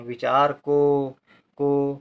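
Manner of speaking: each syllable drawn out slowly for dictation. Shown as a demonstration. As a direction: stretch विचार को so students can write along. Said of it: "का के साथ ओ का उच्चारण". (धीरे-धीरे बोलकर लिखवाना)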